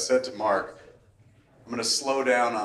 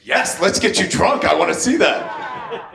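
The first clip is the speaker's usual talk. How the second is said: excited; 7 semitones higher, 4 dB louder than usual. Retold or imitated. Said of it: "the most excited I've ever seen Josh".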